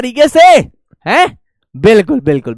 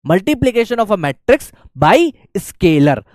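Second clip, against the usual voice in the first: very slow "multiplication of a matrix by scalar" once more; slow dictation; much slower than usual. Shown as a demonstration. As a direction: repeat the title slowly for notes. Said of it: "next अपने पास आता है". (फिर से धीरे-धीरे लिखवाना)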